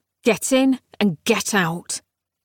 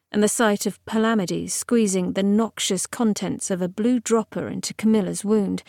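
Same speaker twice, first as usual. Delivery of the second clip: usual speaking voice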